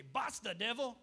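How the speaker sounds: firm "Basta, devil" with a sharp rise on "Basta"; firm Dutch rebuke. Shown as a demonstration. Preacher teaching the Dutch rebuke to his church, firm. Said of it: "I like how they say it in Dutch".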